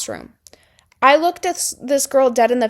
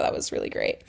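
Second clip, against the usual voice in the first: whispered sarcasm; 9 dB quieter, faster than usual. Real, not acted.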